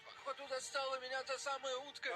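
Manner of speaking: shocked. Retold or imitated. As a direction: blurt it out in disbelief. Retells own shocked reply.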